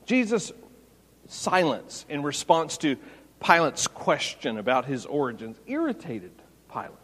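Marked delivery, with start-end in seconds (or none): none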